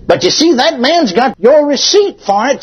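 high-pitched fervent preaching. Acted. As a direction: lift the pitch and preach it to the congregation. Preacher raises voice to exhort.

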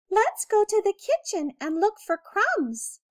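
a hungry little mouse keen on an adventure; eager little mouse. A narrator's reading. Mouse Alexander inviting Willie to look for crumbs, eager.

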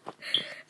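quiet breathy giggle; soft laugh. Real, not acted. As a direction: let a small giggle slip out. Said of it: "I don't know why I'm laughing".

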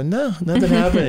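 high-pitched, mock-innocent denial; lying denial. Hiding last night from his old girlfriend, lying denial. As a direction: play an unconvincing cover-up. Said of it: "some so-called Christians could lie".

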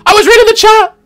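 high, loud indignant shout. Real, not acted. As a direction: shout it out in protest. Defending himself against teasing.

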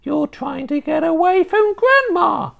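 put-on high, sly old hag; old witch voice. A narrator's reading. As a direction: needle him as a witchy grandma. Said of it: "she said".

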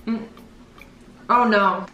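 talking with food in mouth; disgusted while chewing; chewing a jelly bean. Real, not acted. Seen 0: Red-sweater woman ate a bean at -5, grimaces and covers mouth.

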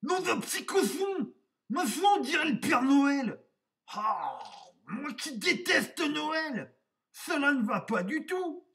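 high, loud, indignant outburst; put-on high wolf voice, exasperated; exclaiming wolf. A narrator's reading. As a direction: squawk with outrage at the mirror. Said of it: "et s'exclama".

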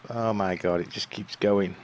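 normal talking voice

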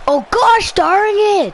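high, loud, drawn-out groan; frustrated cry. Real, not acted. Boy groaning loudly after a near miss. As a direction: let the frustration burst out slowly. Just missed a goal.

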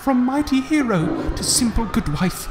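put-on high child voice, not soft; childlike voice. A hint of a child's voice speaking over the carnage. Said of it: "quiet and childlike, speaks softly".

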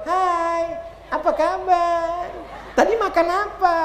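put-on a chirpy parent phoning their child; mock cheerful parent. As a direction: tease the doting parent. Mocking parents' video calls.

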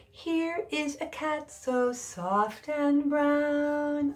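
put-on slow chanted children's rhyme; sing-song rhyme. Shown as a demonstration. Teacher chanting a Halloween rhyme for children. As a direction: chant it gently for little kids.

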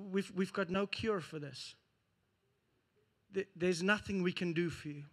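doctor softly telling the woman there is no cure; hopeless diagnosis. Acted. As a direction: quietly tell her nothing can be done.

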